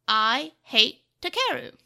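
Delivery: slow, loud, word by word; cruel quote. A narrator's reading. Devimon quotes the brother to wound Takeru, slow and emphatic. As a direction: land each word like a blow.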